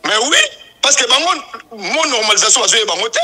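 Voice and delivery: loud, forceful objection; heated rebuttal. Real, not acted. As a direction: push the argument out loudly. Heated rebuttal about normalisation committee. (koswana na mongongo ya makasi)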